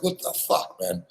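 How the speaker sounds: normal talking voice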